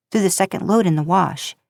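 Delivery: ordinary delivery, nothing marked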